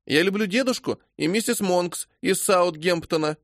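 put-on high, childish refusal; high child voice, stubborn; little boy. A narrator's reading. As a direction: push the stranger away. Child rejects stranger father.

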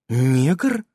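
НЕГР lifted into a puzzled question; questioning intonation. A narrator's reading. Nasa doesn't know the word, НЕГР rising questioningly. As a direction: say «Негр» with a clear rise at the end. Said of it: "В голосе Магини появились вопросительные интонации".

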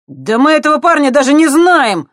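high, loud desperate yell. Acted. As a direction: yell it in panic. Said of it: "завопил Андрей".

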